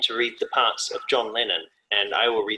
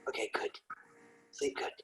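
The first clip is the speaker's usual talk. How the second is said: very soft whisper; acted bedtime hush; hushed goodnight; 17 dB quieter than usual. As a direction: whisper goodnight so as not to disturb. Acting whispering to sleeping partner.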